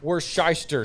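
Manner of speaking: Worcestershire said as "wor-SHY-ster"; Worcestershire with "shy" in the middle; fumbled pronunciation. Shown as a demonstration. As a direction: mangle Worcestershire into "war-shyster". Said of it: "how would you pronounce".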